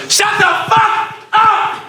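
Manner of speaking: very high, slow, drawn-out yell; train guy yelling. Retold or imitated. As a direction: bellow it across a silent car. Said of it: "this dude just gets on and goes".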